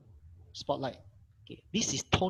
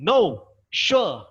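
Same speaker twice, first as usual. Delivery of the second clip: "No, sure" loud and falling; exclamation-tone "No, sure"; 2 semitones higher, 8 dB louder than usual. Shown as a demonstration. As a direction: shout "No, sure" with a sharp drop. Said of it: "You pronounce as you are saying".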